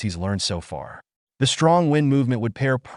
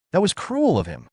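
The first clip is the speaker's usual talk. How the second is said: higher-pitched, understated cry of frustration; frustrated mock shout; 7 semitones higher than usual. A narrator's reading. Wu Mun alone in his tent after his brother's snub, mildly voiced frustrated shout. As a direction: narrate a frustrated shout without raising the volume. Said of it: "his frustration peaks as he punches his mat and shouts".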